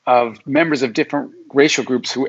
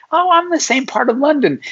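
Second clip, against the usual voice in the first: much higher, eager answer; delighted reply; 12 semitones higher than usual. Retold or imitated. Stranger abroad answers a fellow Londoner, delighted. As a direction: answer like finding a long-lost neighbour. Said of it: "you go".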